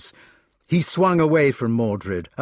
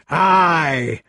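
loud, higher, drawn-out bellow; roar; 4 semitones higher, 5 dB louder than usual. A narrator's reading. Gawain at the sight of steel, roaring. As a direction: roar it in blind rage. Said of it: "roared Gawain".

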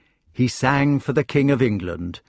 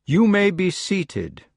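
regal; 8 semitones higher, slower than usual. Acted. King addresses council.

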